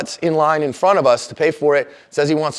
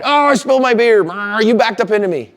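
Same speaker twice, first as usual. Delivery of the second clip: mock angry complaint; 7 semitones higher, 3 dB louder than usual. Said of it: "And he's all mad".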